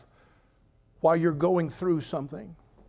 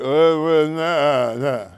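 loud, slow, dull caveman grunting; dull relatives mumbling; 8 dB louder, much slower than usual. Retold or imitated. Pastor mocks dull relatives grunting at dinner. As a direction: play the boring prehistoric relatives.

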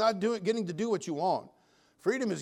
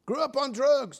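imitated testimony; 7 semitones higher, 5 dB louder than usual. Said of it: "gave his testimony".

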